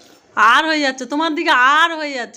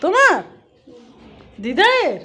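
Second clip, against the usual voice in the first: playful teasing; slower than usual. Real, not acted. Playful quiz game with toddler. (খেলাচ্ছলে প্রশ্ন)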